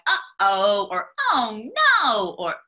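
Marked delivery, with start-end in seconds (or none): 0.1-0.9 loud sing-song uh-oh; playful uh-oh. Shown as a demonstration. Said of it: "something like". 1.3-2.4 loud rising then falling oh no; playful oh no. Shown as a demonstration. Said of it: "something like".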